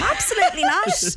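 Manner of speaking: high squeal of a laughing no; laughing denial. Real, not acted. To friends on jorts in the local pub, laughing no. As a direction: laugh it out in disbelief.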